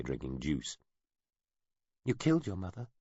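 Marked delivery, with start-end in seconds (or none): 2.0-2.9 put-on high child voice, sing-song accusation; a child blurting out something cruel; child's taunt. A narrator's reading. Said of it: "Rennequet said suddenly".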